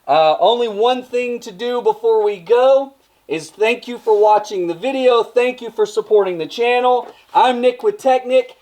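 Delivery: high, projected sign-off; authoritative yelling. Pretend. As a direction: holler the outro from inside a helmet. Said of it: "I'm instead gonna yell at you".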